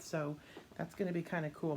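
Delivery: usual speaking voice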